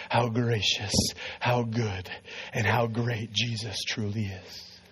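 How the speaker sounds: low, soft, reverent close; quiet, deep-pitched altar call; hushed preaching. Acted. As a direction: drop low and let it fade softly.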